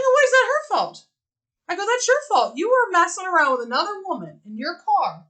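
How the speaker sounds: scolding him, up high and sharp; retold angry scolding. Retelling a quarrel with a cheater.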